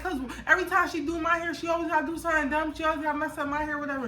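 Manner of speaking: high, rushed griping; retold annoyance. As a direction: rattle off the complaint, imitating a mad daughter. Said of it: "I was just so mad".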